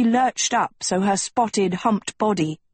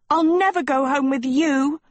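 screamed refusal; 7 semitones higher than usual. A narrator's reading. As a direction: refuse furiously in a high voice. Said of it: "screamed Sophie".

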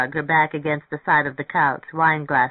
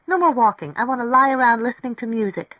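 higher, livelier woman character; bubbly, impatient eagerness; eager insistence; 7 semitones higher, 5 dB louder than usual. Acted. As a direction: push back with excited energy. Mary Ann excitedly refuses walking.

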